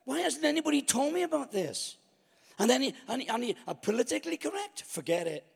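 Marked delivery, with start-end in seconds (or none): 0.0-2.0 high, wide-eyed thrill at a miracle; high-pitched awestruck thinking aloud; amazed inner voice. Retold or imitated. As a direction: sound like a kid discovering something astonishing. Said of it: "I thought".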